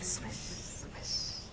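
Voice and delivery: breathy unvoiced swish sound; whispered swish. A narrator's reading. Storyteller making the leaves' sound as a whisper. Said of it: "leaves fell on the roof".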